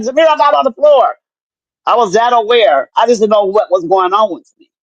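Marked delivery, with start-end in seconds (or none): 0.0-1.2 higher-pitched insistent denial; mock bickering with a grandson, voice raised in pitch; retold arguing. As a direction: argue back as if retelling a spat. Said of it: "me and my grandson was arguing".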